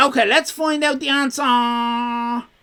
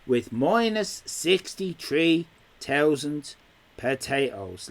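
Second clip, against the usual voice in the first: ordinary talk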